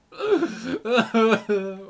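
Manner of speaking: laughing half-sob, overcome by the character; a loud laugh that turns into a fake sob; mock crying laugh. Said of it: "I'll stop crying now".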